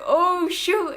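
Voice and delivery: high, loud startled gasp of words; startled oh shoot. Retold or imitated. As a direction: blurt it as if just caught. Caught lying, mom turns.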